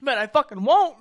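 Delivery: high, loud retort; defiant refusal. Retold or imitated. Drinker answering heckling friends, loud defiant refusal. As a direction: push back hard at the dare. Retelling defiant reply to dare.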